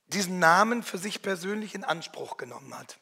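normal talking voice

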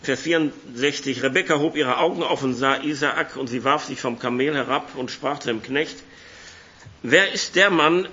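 ordinary delivery

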